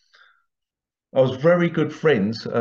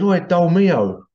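higher, drawn-out mock call; 5 semitones higher, 4 dB louder, slower than usual. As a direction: imitate a workmate's teasing holler. Workmate's call repeated in story.

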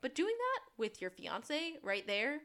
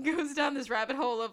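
ordinary talk, nothing marked

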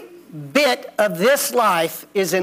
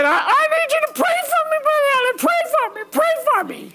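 much higher, fast, whiny begging; drunk kid begging; faster than usual. Retold or imitated. The drunk kid begs the preacher to pray, high whiny pleading. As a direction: plead over and over like a blubbering drunk. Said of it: "He said".

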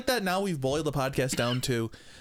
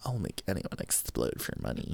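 much lower, quieter villain growl; cartoon villain voice; 10 semitones lower, 7 dB quieter than usual. Doing a villain line for laughs, low growl. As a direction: growl it like a cartoon bad guy. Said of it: "I know that voice".

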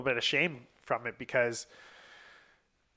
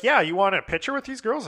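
much higher, eager friend voice; imitating excited friend; 9 semitones higher, 4 dB louder than usual. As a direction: pitch it up like a keen buddy. Imitating friend offering a photo.

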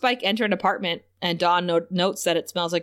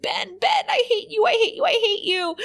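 much higher mock wail of hate; hammed-up villain tantrum, pitched way up; Glory raging at Ben; 13 semitones higher than usual. Glory losing it over Ben, in a high mock screech. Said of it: "She's like, Glory loses it".

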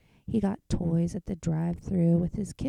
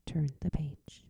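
soft whispered page cue; 3 semitones lower, 4 dB quieter than usual. Real, not acted. Reader whispers to the listener to turn the page.

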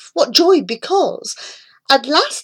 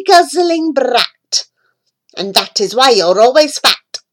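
scolding mother; 5 semitones higher, 4 dB louder than usual. A narrator's reading. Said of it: "my mother cried".

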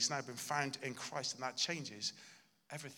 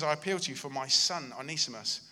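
"I appeal to you" called out loud and hard; loud insistent plea to Philemon; forceful appeal; 8 dB louder than usual. Retold or imitated. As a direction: hammer the plea home. Preacher repeats Paul's plea.

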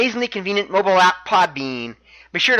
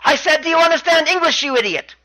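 high, irritated question dropping onto the insult; mock fed-up demand, pitched up; imitated exasperation; 9 semitones higher than usual. Champion asks challenger about his English, high imitated exasperation. Neville insults uncomprehending Tozawa.

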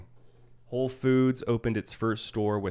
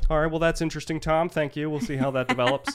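high, loud TV anchor delivery; news reporter voice; 9 semitones higher, 5 dB louder than usual. Pretend. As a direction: put on a bright newscaster voice. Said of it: "I wanna talk like a reporter".

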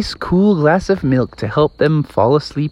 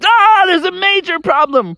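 very high panicked yelp; alarmed firefighters. Acted. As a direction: squeal in sudden alarm. Firefighters see the python.

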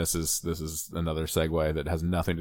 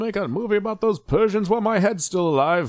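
put-on high, shouty old tycoon voice; ranting old Walt Disney; 13 semitones higher, 3 dB louder than usual. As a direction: rant it as a cranky frozen mogul. Voicing Walt Disney's frozen head.

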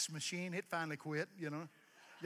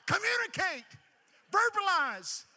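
exasperated shout; 12 dB louder than usual. Real, not acted. Exasperated at texting grandkids.

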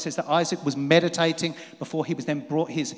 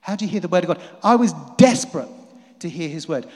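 normal talking voice

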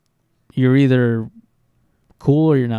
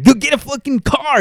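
much higher teasing yell; mock yell; 13 semitones higher than usual. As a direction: heckle him with a pitched-up mock shout. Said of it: "Was like".

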